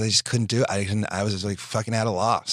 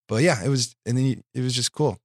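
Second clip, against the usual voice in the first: ordinary delivery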